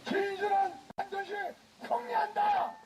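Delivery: high, shouted rally cry; roaring rallying speech. Real, not acted. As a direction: shout it out to the crowd. Leading crowd chant at rally.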